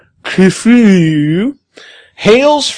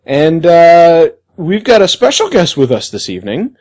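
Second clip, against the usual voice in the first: ordinary talk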